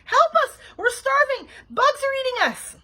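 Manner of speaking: high, loud melodramatic cries; hollering. A narrator's reading. Trapped Bean begging the babysitter, hollering. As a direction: holler each plea at full volume. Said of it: "hollered Bean".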